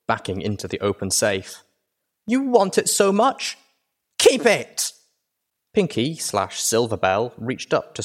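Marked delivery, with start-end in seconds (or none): 2.3-4.9 put-on much higher and louder, shrill child's rage; furious filly shout. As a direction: hurl it at him in fury. Filly snaps, shoving safe closed.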